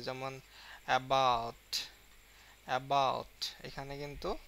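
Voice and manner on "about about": "about" with a heavy, louder BOUT; slow demo of "about", pressure on its second half; stressed second syllable. Shown as a demonstration. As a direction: lean hard on the "bout" in "about". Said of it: "চাপ দিতে হয়".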